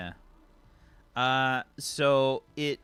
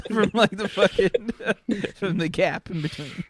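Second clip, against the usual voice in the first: higher voice breaking up with laughter; laughing; 5 semitones higher than usual. Real, not acted. Game master describing the data pad, really laughing. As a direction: struggle to get the description out through laughs.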